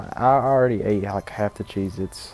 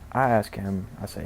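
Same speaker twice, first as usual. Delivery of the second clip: ordinary delivery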